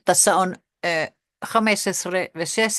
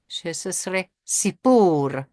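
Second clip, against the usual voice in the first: "se sesre sipur" said very slowly; slow demo; much slower than usual. Shown as a demonstration. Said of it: "Beispiel langsam vorgesprochen".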